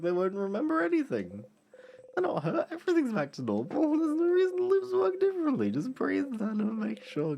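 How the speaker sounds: panicked hero convincing himself; frantic self-reassurance. Acted. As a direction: talk fast to stay calm.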